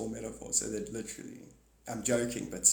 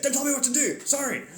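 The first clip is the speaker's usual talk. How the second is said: much higher, louder indignant snap then sorry; mock snappy retort; 11 semitones higher, 5 dB louder than usual. Sneezer rejecting the blessing, squeaky indignant snap. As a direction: snap back in a squeaky huff. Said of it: "You're like".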